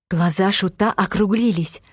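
plain reading voice

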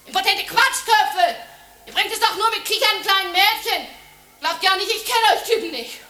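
high, loud, contemptuous yelling; furious rant. Acted. Woman ranting at the men she threw out, loud and high. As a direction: tear into them with scorn.

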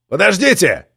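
high call to the boats; shouting. A narrator's reading. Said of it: "крикнул он полицейским на катерях".

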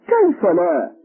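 loud, high, incredulous question; stunned. A narrator's reading. Policeman told he has the wrong man, loudly stunned. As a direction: burst out in disbelief. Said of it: "فذهل الشرطي وقال".

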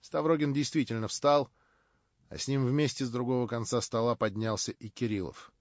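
ordinary delivery, nothing marked

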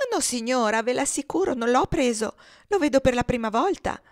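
earnest-sounding little fibber; protesting innocence. Acted. Girl protests her innocence.

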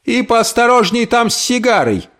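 high sharp warning; lightly voiced aunt warning. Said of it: "предупредила Тильди".

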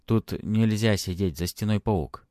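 ordinary delivery

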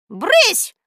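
high, loud, sharp shooing; stern. A narrator's reading. Old woman shooing her hissing cat, stern. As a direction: scold sharply. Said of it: "строго сказала старушка".

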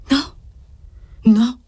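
tremulous denial; trembling. A narrator's reading. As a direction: blurt a frightened no, then repeat it harder. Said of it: "tremando sempre".

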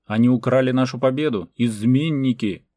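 loud, outraged accusation; full-voiced cry against traitors; desperate yell. A narrator's reading. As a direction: shout it out, dragging the final word. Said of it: "отчаянно заорал Мартан".

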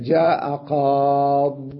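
جاء قاض very slow, "qaaad" closed on a bare d; قاض drawn out with no final vowel; stop on silent daad. Shown as a demonstration. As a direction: stretch the aa in قاض, then stop hard on daad. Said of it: "يقفون على الضاد بالسكون".

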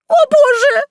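very high, loud squeak; shrieking in panic; terrified squeal. A narrator's reading. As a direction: squeal it out in fright. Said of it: "запищала Василиса".

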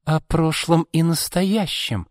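high, slow, pointed declaration; declaring. A narrator's reading. Izolda hints at family secrets to Arkady, declaring. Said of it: "заявила Изольда".

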